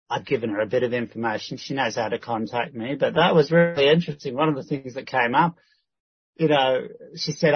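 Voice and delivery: usual speaking voice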